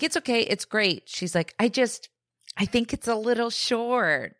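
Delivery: a hint of a brave little girl putting on a good face; voicing young daughter.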